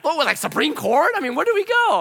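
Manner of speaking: high, loud incredulous questions; exasperated questioning. Retold or imitated. Asking the man where to go, exasperated. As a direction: demand an answer in comic exasperation.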